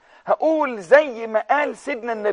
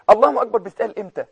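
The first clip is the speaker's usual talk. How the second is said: ordinary talk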